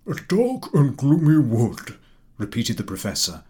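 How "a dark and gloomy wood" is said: slow, savoured approving repeat. A narrator's reading. Professor repeating the good answer with approval. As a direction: relish the answer. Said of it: "with evident approval".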